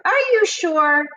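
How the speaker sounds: high-pitched doubting question; skeptical questioning. Acted. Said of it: "skeptical basically is like you're questioning".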